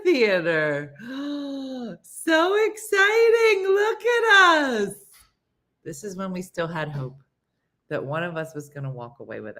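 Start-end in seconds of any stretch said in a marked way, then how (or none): 1.0-1.9 high, drawn-in gasp; excited gasp. Pretend. Teller shows photo walking into theater, excited gasp. 2.0-4.9 very high, slow, drawn-out squeal; gushing excitement. Pretend. Showing photo of friends entering theater, gushing excitement.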